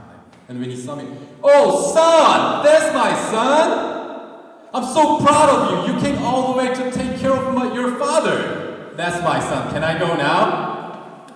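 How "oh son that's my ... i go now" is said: put-on much higher and louder, sloppy drunk gushing; drunk yelling dad. Drunk dad at the police station, loudly gushing over son. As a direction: bellow it like a proud drunk, half singing. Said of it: "it was like yelling all the time".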